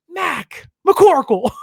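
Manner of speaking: high, slow, loud yell of disbelief; exasperated shout. Real, not acted. Fan yelling at Mac after the sack, loud high exasperated. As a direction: shout his name in outrage.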